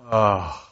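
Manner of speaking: breath let out in exasperation; deep sigh. Shown as a demonstration. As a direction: show how a deep sigh sounds. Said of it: "you kinda do that with your mouth".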